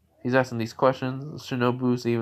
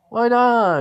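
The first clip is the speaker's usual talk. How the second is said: whiny protest; 12 semitones higher, 7 dB louder than usual. Acted. Zenitsu protesting refusal.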